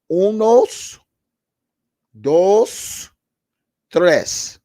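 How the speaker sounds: loud, slow count in Spanish. Real, not acted. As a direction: shout out unos, dos, tres one by one. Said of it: "Let me say it in Spanish".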